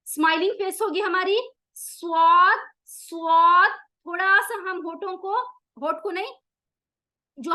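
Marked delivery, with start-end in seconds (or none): 1.8-3.7 स्वाद said loud and full with the tongue curled; heavy saad. Shown as a demonstration. Teacher contrasts heavy saad with thin seen, loud. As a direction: make स्वाद thick and heavy. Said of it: "स्वाद मोटा हरूफ है".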